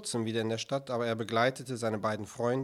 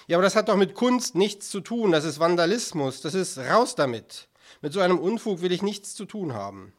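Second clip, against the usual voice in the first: raised, louder protest; indignant outburst; 4 semitones higher, 5 dB louder than usual. Acted. Director rejects the artists' exhibit, indignant. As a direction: object loudly, wanting no part of it.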